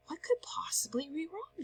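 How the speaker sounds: whispered worried question to herself; soft worried whisper; asked herself. A narrator's reading. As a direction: breathe it out under the breath. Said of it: "she asked herself".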